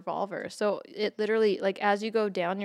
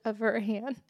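ordinary delivery

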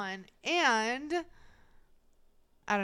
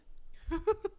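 put-on high giggling old-lady cackle; a playful giggling granny impression; giggling granny laugh; 11 semitones higher than usual. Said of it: "Is that your impression?".

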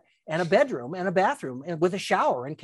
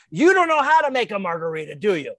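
exasperated scolding; 2 semitones higher, 6 dB louder than usual. Retold or imitated. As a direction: boss losing patience, taking over the blender. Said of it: "'cause she was really upset".

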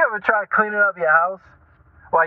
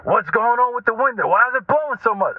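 higher-pitched mock exasperation; getting mad; 7 semitones higher than usual. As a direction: act out mild irrational annoyance. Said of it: "I'm getting mad at".

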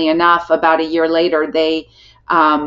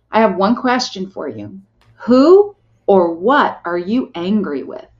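pointed confrontational question. Retold or imitated. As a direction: imitate a doctor confronting a patient, deliberately paced. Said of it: "he looked me square in the eye".

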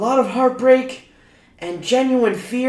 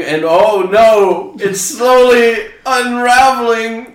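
mock dramatic; 6 dB louder, slower than usual. Mock dramatic disaster narration.

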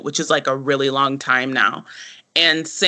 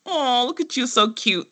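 higher, cooing mock-sweet owner voice; condescending cooing; 8 semitones higher than usual. Mocking the actor playing Tom, higher condescending coo. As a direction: talk down to him like a pet. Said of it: "the way he even says it, that actor is just like".

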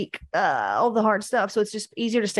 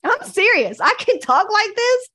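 excited insistence; 13 semitones higher, 7 dB louder than usual. Real, not acted. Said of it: "insisting she was that woman".

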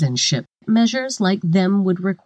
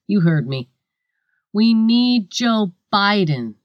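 normal talking voice

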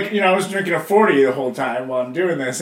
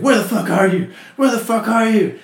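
higher, louder angry demand, said twice; retold angry call; 8 semitones higher, 6 dB louder than usual. Brother on the phone freaking out, angry. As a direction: bark it like a frantic brother.

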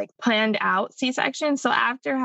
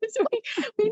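laughing; 12 semitones higher, much faster than usual. Real, not acted. Laughing mid-sentence.

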